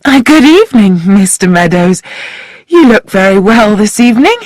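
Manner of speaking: playful hostess greeting. Acted. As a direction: flirt lightly to charm him. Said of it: "took on a playful manner".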